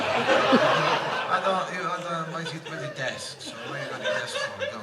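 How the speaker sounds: breaking up with laughter, pitch jumping up; can barely get it out for laughing; laughing through the quote. Real, not acted. Retelling friend's office-desk confusion, laughing.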